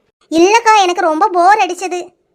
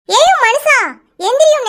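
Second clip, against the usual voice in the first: calling out; 5 semitones higher, slower than usual. Acted. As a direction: call across the house to rouse him. Wife calling sleeping husband awake.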